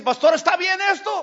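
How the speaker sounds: high, loud voice of a church lady asking; lady asks her pastor whether her gift is enough. Retold or imitated.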